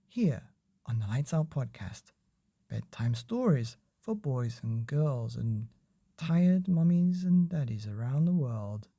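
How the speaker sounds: usual speaking voice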